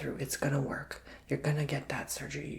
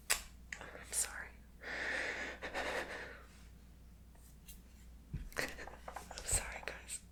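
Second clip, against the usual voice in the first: choked, barely audible whisper through tears; crying apology; 12 dB quieter, much slower than usual. Real, not acted. Tarot reader apologizing to her viewers, whispering through tears. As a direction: force the words out while fighting back tears. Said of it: "I don't even know why I'm crying".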